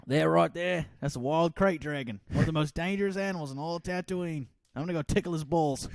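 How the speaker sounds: high, loud mock Aussie guide; wildlife-show Australian excitement; hammed-up croc-hunter voice; Australian accent. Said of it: "not so Australian".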